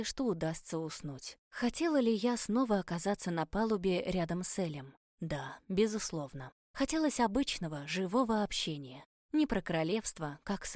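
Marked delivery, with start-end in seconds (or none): none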